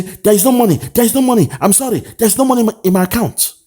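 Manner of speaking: high mocking impression of an official pleading no money; mocking high quote. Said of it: "Na who dey talk say".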